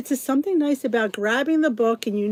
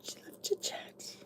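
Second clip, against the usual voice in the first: whispered aside; hushed mock-secret aside; 19 dB quieter than usual. Real, not acted. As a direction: breathe the words out. Whispered end of show title.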